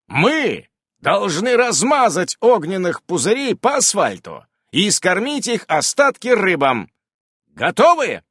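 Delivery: high, emphatic battle speech; general rallying troops. A narrator's reading. Commander urges his fighters before battle, high rallying call. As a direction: fire up the soldiers.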